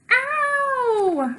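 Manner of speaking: high loud yelp of pain; child's scream. Acted. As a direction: cry out at the needle. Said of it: "I scream and cry".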